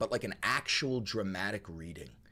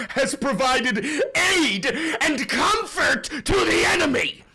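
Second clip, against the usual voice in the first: building louder and higher to an outraged peak; dramatic shout; 10 semitones higher, 7 dB louder than usual. Shown as a demonstration. Streamer shouts the climax of the dramatic reading. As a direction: swell into a furious climax. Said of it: "dramatic reading".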